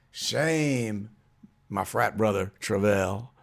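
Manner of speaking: ordinary talk, nothing marked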